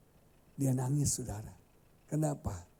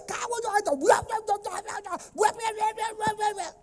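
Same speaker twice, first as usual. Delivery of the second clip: angry parent. Retold or imitated. Angry parent scolding the child over the melon. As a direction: sputter in rage at the child. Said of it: "Kita pukul dia".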